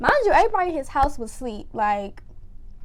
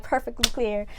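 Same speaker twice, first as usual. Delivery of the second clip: ordinary talk, nothing marked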